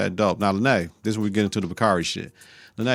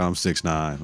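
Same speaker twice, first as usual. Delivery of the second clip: deep Rob voice; 6 semitones lower, 4 dB quieter, slower than usual. Retold or imitated. Rob coolly answering Dante about his height, low and calm. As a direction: lower and softer, a calm tall man answering. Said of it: "Rob like".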